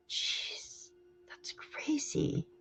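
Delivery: slow hushed disbelief; stunned whisper. Real, not acted. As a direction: breathe it out in shock. Stunned reaction to gas price.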